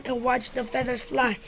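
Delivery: usual speaking voice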